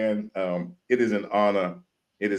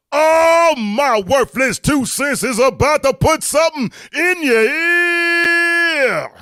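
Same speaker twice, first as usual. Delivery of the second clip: high, loud, stretched-out announcer hype; hyped radio host intro; 8 dB louder, slower than usual. Acted. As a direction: pump up the crowd like a live radio show host.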